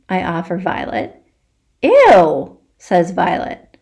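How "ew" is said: loud, high, grossed-out Ew; disgusted ew. Acted. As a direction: recoil in disgust. Violet disgusted at offer.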